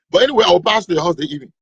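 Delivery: usual speaking voice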